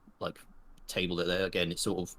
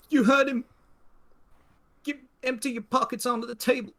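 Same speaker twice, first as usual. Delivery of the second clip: put-on high-pitched nervous young robber; jittery, halting hold-up demand; shaky, nervous; 4 dB louder than usual. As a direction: give orders while losing your nerve. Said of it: "a bit of a shake to him".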